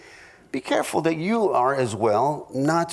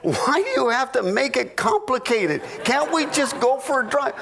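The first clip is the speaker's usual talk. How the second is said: higher, whiny mock wife; higher mock-exasperated wife; 7 semitones higher than usual. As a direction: play the wife pitched up, fed up with the question. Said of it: "And she said".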